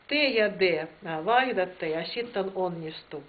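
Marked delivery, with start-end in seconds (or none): none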